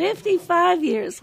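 high, surprised repeat of the number; impressed echo. Real, not acted. Host echoes the figure, impressed.

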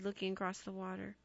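plain reading voice

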